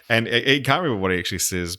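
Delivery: ordinary talk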